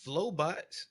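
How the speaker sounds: usual speaking voice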